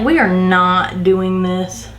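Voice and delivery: slow, weighted "not" in "We are not doing this"; firm refusal. Retold or imitated. Worker done excusing a coworker's behavior, slow stress on "not". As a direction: space the words out, landing hard on "not".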